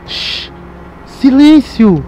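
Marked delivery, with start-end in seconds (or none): 0.1-0.5 librarian shushing whisper. Real, not acted. Near a sleeping figure.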